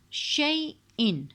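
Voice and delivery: شیئن drawn out slowly with a hamza catch; slow recitation. Shown as a demonstration. Teacher reciting شیئن to show the hamza. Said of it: "یہ بھی حمزہ ہے".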